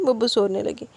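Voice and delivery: ordinary delivery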